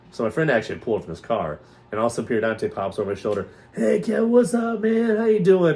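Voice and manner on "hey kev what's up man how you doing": high, loud, exuberant hello; boisterous greeting. Retold or imitated. Actor popping up to greet a startled friend, boisterous greeting. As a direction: burst in loudly, pitched right up.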